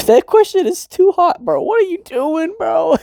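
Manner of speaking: high-pitched, laughing "bro" reaction; laughing disbelief. Real, not acted. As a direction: squeal it out in amused shock. Laughing reaction to Kazuya's thought.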